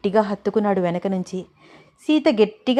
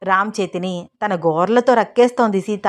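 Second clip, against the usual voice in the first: plain reading voice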